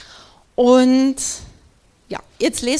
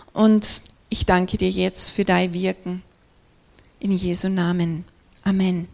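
prayer. Real, not acted. Opening prayer before the sermon.

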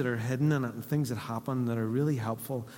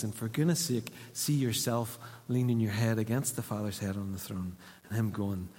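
usual speaking voice

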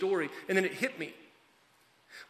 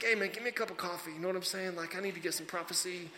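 sleepy and casual; 3 semitones lower, 10 dB quieter than usual. Acted. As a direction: toss it off like you just rolled out of bed. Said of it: "he yawns, stretches".

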